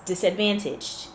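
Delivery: ordinary talk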